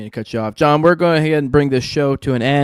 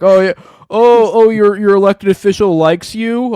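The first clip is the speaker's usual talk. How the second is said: mocking; 5 semitones higher, 5 dB louder than usual. Mocking the politician.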